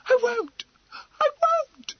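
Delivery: very high, loud, panicked refusal; almost shrieking. Acted. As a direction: refuse in a rising near shriek. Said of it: "almost shrieked Emily".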